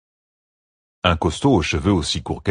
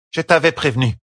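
Tesline's told-you-so; 8 semitones higher than usual. A narrator's reading. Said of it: "lâcha Tesline".

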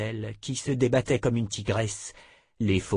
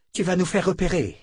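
higher, pressing whisper-free scold; tense warning; 8 semitones higher, 3 dB louder than usual. A narrator's reading. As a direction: hush her with urgency. Blonde scolds. (la blonde avertit, plus aigu et pressant)

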